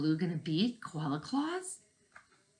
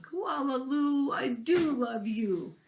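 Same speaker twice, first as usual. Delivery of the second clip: higher, slow tender mother voice; loving mother; 9 semitones higher, 4 dB louder, slower than usual. Acted. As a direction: coo it gently like the mother. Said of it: "imagined her saying".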